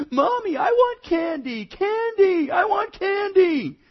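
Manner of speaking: put-on high, loud whining child demanding candy; a four-year-old wailing for candy; whiny child crying out. Preacher voicing a child wailing for candy at checkout, high whine. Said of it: "crying out".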